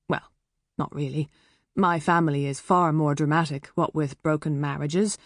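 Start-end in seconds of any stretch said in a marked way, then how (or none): none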